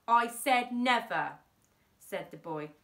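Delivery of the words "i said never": defiant refusal. A narrator's reading. Boy refuses the henchmen.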